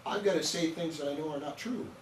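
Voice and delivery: ordinary delivery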